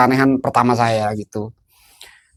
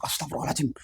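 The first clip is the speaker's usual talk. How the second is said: hushed, startled prayer under the breath; startled waking; 15 dB quieter, much faster than usual. Retold or imitated. Man waking from tiger dream, retold hushed shock. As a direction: retell a shocked awakening very softly. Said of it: "kaget gitu".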